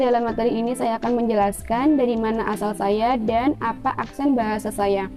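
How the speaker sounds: normal talking voice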